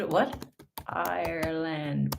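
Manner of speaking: ordinary talk, nothing marked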